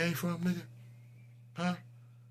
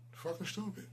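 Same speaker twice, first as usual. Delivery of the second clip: softer, half to himself, rising end; a quieter disgusted repeat; muttered insult; 4 semitones higher, 13 dB quieter than usual. Acted. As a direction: mutter it under your breath. Repeating insult, fading away.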